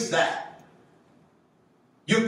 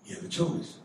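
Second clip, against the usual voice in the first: soft solemn reminder; 3 semitones lower, 8 dB quieter than usual. Retold or imitated. Solemn voice of God.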